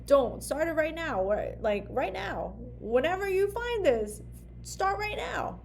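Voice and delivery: high, punchy push to act now; rally them to start today; emphatic urging. Real, not acted. Coach urging her viewers to start now, high and emphatic.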